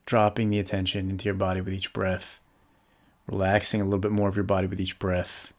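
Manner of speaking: calm, softly settling meditation voice with long pauses; guided meditation. Real, not acted. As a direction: guide them gently into the body, leaving space to breathe.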